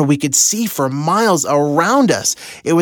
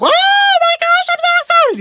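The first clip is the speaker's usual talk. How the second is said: far higher, squealing excited wife voice; imitating his wife; 3 dB louder than usual. Husband mimicking Heather's reaction to the surprise, high-pitched squeal. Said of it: "'cause that's how she talks".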